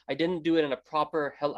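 normal talking voice

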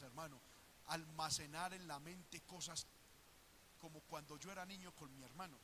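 normal talking voice

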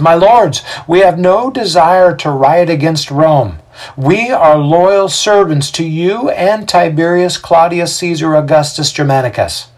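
high, loud public declaration; Xander, crowd orator. A narrator's reading. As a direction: proclaim loyalty to the magistrates. Voices Xander addressing magistrates.

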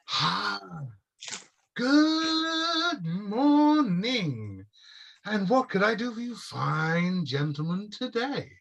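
high, sing-song elf greeting; elf shopkeeper. Acted. Shop elf greeting the party in a sing-song voice. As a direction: sing the welcome like a prim shopkeeper.

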